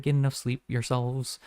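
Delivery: normal talking voice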